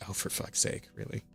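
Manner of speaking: breathy, exasperated aside under the breath; whispered aside mocking the article. Real, not acted. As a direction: sigh it out, fed up with the writer. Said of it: "exasperated aside while reading".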